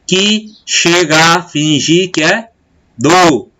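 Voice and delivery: "Que chega a fingir que é do" in slow separate beats, touching vowels merged; scanning the syllables. Shown as a demonstration. Teacher scanning a verse for students, slow beats with merged vowels. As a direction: beat out the verse, "chega a" as "che-ga". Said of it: "Você pronuncia de uma maneira só".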